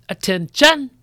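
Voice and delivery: loud, high yell of attention; drill-style yell. Acted. Teacher calling second and third graders to line up, yelled. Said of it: "I yell".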